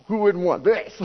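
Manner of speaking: high, loud, pleased mock bravado; a man admiring his belly in the mirror; proud man. Man at mirror praising his gut, loud mock pride. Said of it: "and we're going".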